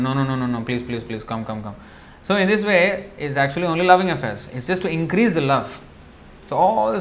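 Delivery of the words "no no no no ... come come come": softer, fading pleading repeats; coaxing plea. Retold or imitated. Cowherd boys coaxing a crying Krishna, soft and pleading. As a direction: gently coax a sulking friend back. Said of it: "cowherd boys immediately come to him".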